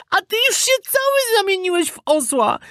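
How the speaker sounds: very high-pitched retort; alarmed puppet. A narrator's reading. Puppet seeing his friend turned donkey, alarmed. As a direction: fire back in a piping puppet voice.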